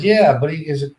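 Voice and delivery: usual speaking voice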